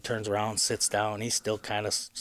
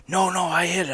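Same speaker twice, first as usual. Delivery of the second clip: insistent denial; 7 semitones higher than usual. Retold or imitated. Dad denies that he missed.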